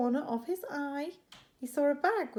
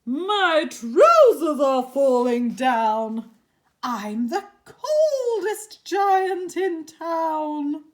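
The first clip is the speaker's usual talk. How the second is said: loud, slow, higher lament; dismayed wail; 6 semitones higher, 14 dB louder, slower than usual. Acted. Giant realising he is cold, loud and slow. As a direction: wail it out slowly, much louder.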